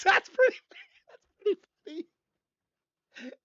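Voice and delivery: high, breaking up with laughter; real laughter. Real, not acted. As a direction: squeeze the words out between laughs. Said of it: "'cause I'm laughing".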